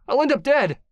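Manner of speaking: very high, loud fearful cry; high panicked wail; terrified. Acted. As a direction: afraid of being killed. Captive fearing retaliation.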